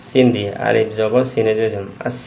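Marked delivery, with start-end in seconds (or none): 2.1-2.2 "আস" with a whistling s at the end; hissed sin. Shown as a demonstration. Teacher showing the sin sound, "আস" with sibilant ending. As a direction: draw out a thin s on "আস". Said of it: "সি, সি শব্দটি উচ্চারণ হবে".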